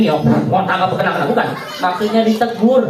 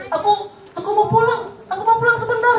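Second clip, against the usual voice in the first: a higher, timid-sounding voice for the companion; Abu Dujana's voice; 12 semitones higher, faster than usual. Acted. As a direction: answer shyly as Abu Dujana. Abu Dujana answers Abu Bakar. (suara Abu Dujana yang lebih tinggi dan malu-malu)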